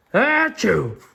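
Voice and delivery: big, high "achoo"; acted sneeze. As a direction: fake a full, loud sneeze. Gerald sneezes again.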